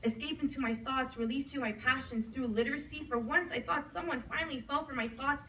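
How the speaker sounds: earnest slam-poetry recital with rhythmic phrasing; spoken-word poem. Acted. Poet to an audience about lost long-distance love, spoken-word recital. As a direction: recite it in flowing runs, pausing between images.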